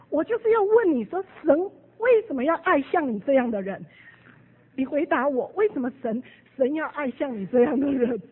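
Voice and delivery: angry challenge. Retold or imitated. Deere pressing the theologian, angry loud challenge. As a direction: demand an answer, hard. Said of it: "所以他就很生气".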